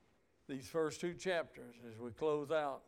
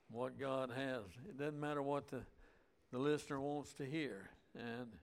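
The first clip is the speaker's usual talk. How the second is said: ordinary talk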